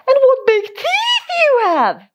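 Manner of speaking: very high gasping surprise; gasped. Acted. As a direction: squeak it out in shock.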